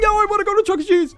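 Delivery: very high-pitched kid wail, imitated; mock desperate child's plea; breaking down pleading. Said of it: "of course, I'd break".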